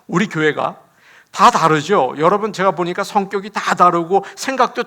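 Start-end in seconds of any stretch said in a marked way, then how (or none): none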